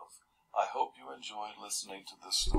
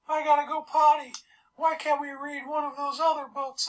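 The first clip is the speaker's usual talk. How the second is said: put-on much higher, louder childish voice rattling off complaints; whiny little kid; 8 dB louder than usual. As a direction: play a fidgety kid blurting out one thing after another. Restless kids interrupting story time.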